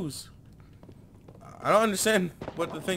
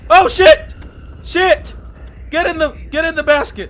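high, loud panicked shouts; panicked yelling; 14 semitones higher, 15 dB louder than usual. Real, not acted. Player panicking during the chase, yelling. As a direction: scramble in panic.

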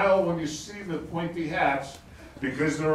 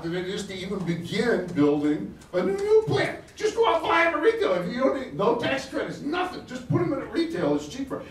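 excited; 7 semitones higher than usual. Real, not acted. Pitching solar to the audience, excited. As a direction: get carried away with enthusiasm. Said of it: "I get pretty excited".

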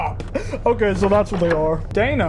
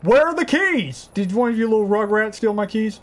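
usual speaking voice

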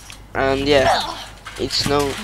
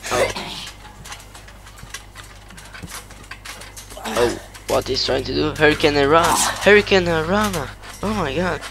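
wrestling commentator. Pretend. Kid calls the match live.

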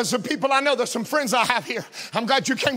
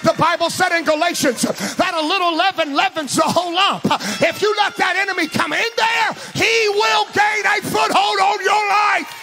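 rising preacher questions, higher and louder; preaching; 6 semitones higher, 3 dB louder than usual. Acted. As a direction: drive the warning home. Climbing sermon questions.

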